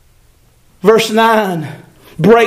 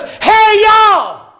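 much higher, hollering at a crowd; mock generic holler; 9 semitones higher, much slower than usual. Preacher mimicking God hollering at the crowd, mock shout. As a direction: call out to nobody in particular, mock shout. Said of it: "Do you think He goes".

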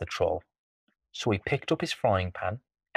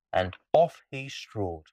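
ordinary delivery

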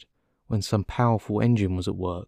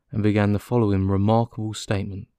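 plain reading voice

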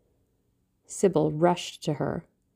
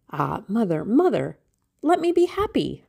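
pleading, climbing to a high cry on happy; rising plea; 5 semitones higher, 3 dB louder than usual. Acted. Sybil begging her mother to let her be happy, pleading high. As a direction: beg her mother, voice soaring higher each word.

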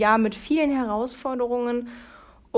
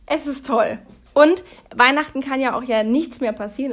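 usual speaking voice

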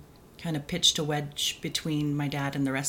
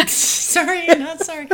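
high laughing burst, much louder; 12 semitones higher, 9 dB louder, slower than usual. Real, not acted. Laughing at awkward question.

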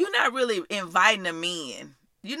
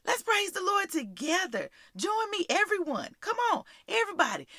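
eager invitation; 10 semitones higher than usual. Shown as a demonstration. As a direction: coax everyone to join in. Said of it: "this is how you start the conversation".

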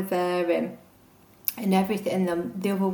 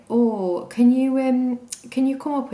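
higher, louder pleading ask; pleading favour; 6 semitones higher, 7 dB louder than usual. Retold or imitated. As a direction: sweetly beg the parents for help. Texting parents asking a favour.

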